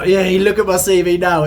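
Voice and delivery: ordinary talk, nothing marked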